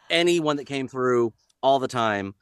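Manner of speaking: usual speaking voice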